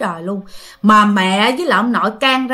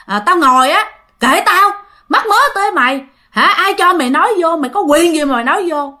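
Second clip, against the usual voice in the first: acted angry scolding, pitched up; chửi mắng; 6 semitones higher, 3 dB louder than usual. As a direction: snap back as a domineering husband, raised voice. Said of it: "ba mới hên chửi nha". (giọng chồng gắt gỏng, chửi vợ)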